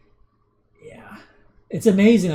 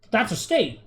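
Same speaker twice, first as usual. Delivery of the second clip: retort; 8 semitones higher than usual. Retold or imitated. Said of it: "you talk to Ryan and says".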